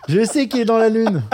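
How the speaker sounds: high, loud burst of exasperation; exasperated. Retold or imitated. Dad fed up with his dreamy son, loudly exasperated. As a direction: raise the voice, acting a fed-up parent.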